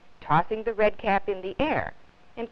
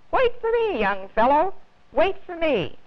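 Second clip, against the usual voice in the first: loud, much higher cheerful shout; cheerful booming call; 9 semitones higher, 4 dB louder than usual. A narrator's reading. Policeman calls to the lost boy, booming and cheerful. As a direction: boom it out through the loudspeaker. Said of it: "cheerful voice booming out".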